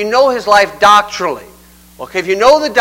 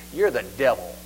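low, hushed condemnation; quiet insult; 9 semitones lower, 11 dB quieter than usual. Retold or imitated. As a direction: drop low and soft to deliver the blow. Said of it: "gave the worst insult".

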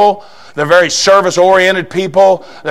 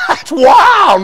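laughing; 12 semitones higher than usual. Real, not acted. Laughing remark.

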